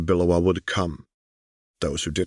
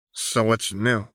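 cold; 4 semitones higher, slower than usual. Acted. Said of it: "achingly cold".

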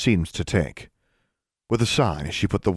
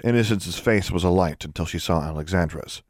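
ordinary delivery, nothing marked

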